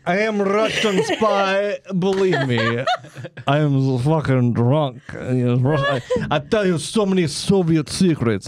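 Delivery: hammed-up Russian accent pleading to be believed; mock Russian accent. Drunk spy pleads with embassy.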